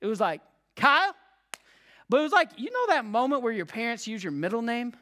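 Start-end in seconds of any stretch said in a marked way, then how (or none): 0.8-1.2 mom calling out. Retold or imitated. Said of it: "a shout of, like, my mom".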